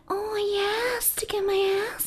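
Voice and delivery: high whispered fake moaning; breathy high moan. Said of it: "she was going".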